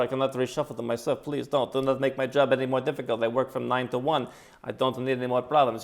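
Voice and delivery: hammed-up Latin American accent for a bored clerk; mock Latin American accent. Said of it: "how to do the right accent".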